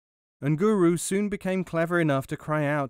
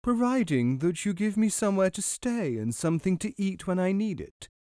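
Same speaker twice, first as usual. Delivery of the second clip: lightly voiced cow, raised pitch; mooing cow; 7 semitones higher than usual. A narrator's reading. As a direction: bargain as a cow in a higher voice. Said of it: "mooed the cow".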